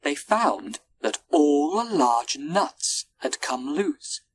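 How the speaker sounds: plain reading voice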